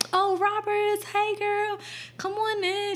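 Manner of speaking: high-pitched, welcoming school nurse imitation; cheery nurse greeting. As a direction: greet a student in a high, friendly voice. Acting out the friendly school nurse.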